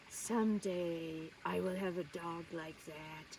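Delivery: low, soft dreamy longing; wistful vow. Retold or imitated. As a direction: murmur it like a secret wish. Child's longing thought, acted out.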